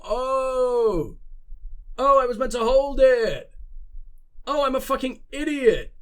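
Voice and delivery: real "oh no" moment, kicking yourself; dismayed realisation. Real, not acted. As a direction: exclaim in sudden self-reproach. Realises own button mistake.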